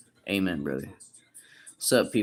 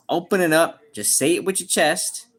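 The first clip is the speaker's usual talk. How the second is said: pep talk push; 7 semitones higher, 5 dB louder than usual. Real, not acted.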